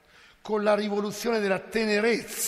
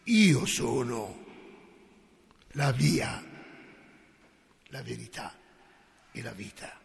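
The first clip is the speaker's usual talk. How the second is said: calm and solemn; 6 semitones lower, slower than usual. Acted. Preacher gives Jesus's answer to Pilate, slow and solemn. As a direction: let each phrase fall slowly with solemn calm. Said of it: "con calma e solennità risponde".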